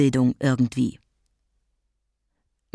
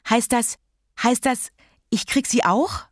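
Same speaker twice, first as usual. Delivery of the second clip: put-on high, hopeful child's question; suddenly eager; 6 semitones higher than usual. A narrator's reading. Child realising she might catch it too, suddenly eager. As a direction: pitch up, rising to an eager question. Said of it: "Sofort saß Kathi kerzengerade".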